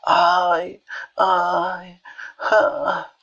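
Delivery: high, drawn-out groans; put-on sickbed whimpering; feigned moaning. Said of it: "quejándose".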